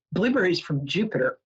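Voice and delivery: ordinary delivery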